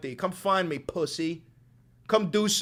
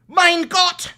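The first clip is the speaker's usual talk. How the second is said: Mein Gott in a hammed-up German accent, high and loud; mock German shout; 13 semitones higher, 10 dB louder than usual. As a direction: yell it like an outraged German zealot.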